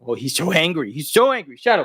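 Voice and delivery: normal talking voice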